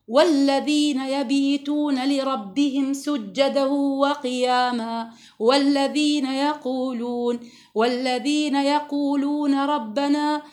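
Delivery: slow, drawn-out chanted recitation; Quran recitation. Acted. Teacher recites the verse to her students in slow chant. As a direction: chant each verse slowly, stretching the vowels.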